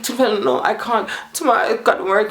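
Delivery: retold protest. Telling how she refused to go to his house, in high protest. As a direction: act out refusing him, pitched well up. Protesting at being taken away.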